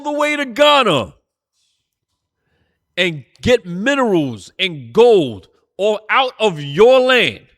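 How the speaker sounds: fiery sermon shout; angry preaching. Real, not acted. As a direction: preach it hot, fury building over the stolen gold. Preacher outraged at injustice.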